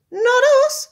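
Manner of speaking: very high, loud chorus of denial; monkeys deny. Acted. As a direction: squeal it all together, innocent.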